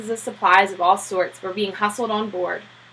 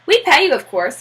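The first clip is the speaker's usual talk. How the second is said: Klein voiced; 5 semitones higher, 5 dB louder than usual. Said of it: "continued Mister Klein".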